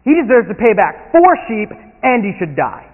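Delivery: high, loud indignant outburst; outraged king. Acted. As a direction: declare the punishment in outrage. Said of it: "In fact, he says".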